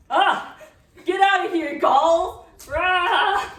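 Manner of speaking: loud, high comic shout; silly clown. Acted. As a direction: yell like a startled cartoon guard. Manlius shouting in the show.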